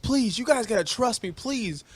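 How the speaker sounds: high, begging please; mock pleading coach. Host mimics Dan Campbell begging his players, mock pleading. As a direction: whine it like a desperate coach. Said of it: "When Dan Campbell was, like, telling the guys".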